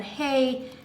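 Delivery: usual speaking voice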